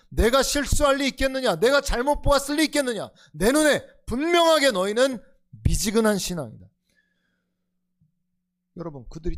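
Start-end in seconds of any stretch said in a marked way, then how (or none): 0.1-1.6 higher and louder, pressing question; Jesus challenging the lukewarm church, forceful; forceful rebuke. Acted. Jesus's words, forceful take. 1.6-3.1 Jesus challenging the lukewarm church, forceful; forceful rebuke. Acted. Jesus's words, forceful take. 3.3-6.7 1 emphatic verdict. Acted. Jesus's verdict.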